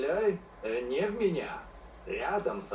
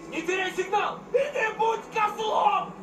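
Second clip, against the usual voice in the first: high, loud panicked yell; panicked shout; 9 semitones higher, 4 dB louder than usual. Acted. Yelling while being carried off.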